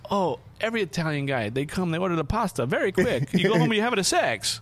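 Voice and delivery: put-on lightly Italian-accented, high and loud explanation; the Italian friend explains the risotto rule, high and loud. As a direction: explain like an Italian uncle sharing the secret. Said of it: "He goes".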